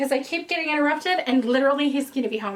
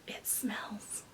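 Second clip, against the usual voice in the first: whispering; 3 semitones lower, 18 dB quieter than usual. Real, not acted. Whispering about a lovely smell.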